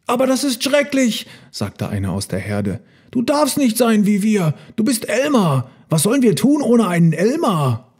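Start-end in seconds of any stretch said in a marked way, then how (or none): 0.1-1.3 high, protesting voice; dismayed elephant. A narrator's reading. Herd member objects to a grey Elmar, high dismay. 3.1-7.9 high, pleading elephant voice; dismayed pleading. A narrator's reading. Herd member pleads with grey Elmar, high dismay.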